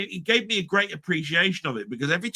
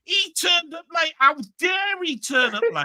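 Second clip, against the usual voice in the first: hammed-up outrage about lateness; mock rant; 11 semitones higher than usual. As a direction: scold him theatrically for being late. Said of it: "I've got a rant at Cy Robson".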